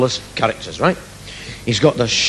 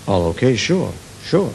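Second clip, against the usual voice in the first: smooth chat-up voice; 4 dB quieter than usual. Acted. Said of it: "deep romantic voice".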